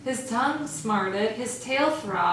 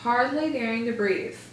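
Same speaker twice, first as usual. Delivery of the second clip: ordinary delivery, nothing marked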